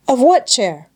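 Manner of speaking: put-on a sulky, evasive boy calling back; morose. A narrator's reading. Peter calling back sulkily to the grandfather, morose. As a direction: grumble the question back up the slope. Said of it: "in a morose tone of voice".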